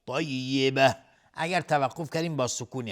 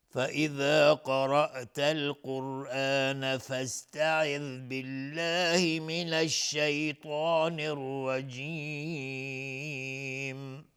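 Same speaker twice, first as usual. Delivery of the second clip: Quran recitation; much slower than usual. Acted. Teacher reciting verse ninety-eight in slow tajweed chant. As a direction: intone slowly and let الرجیم fade.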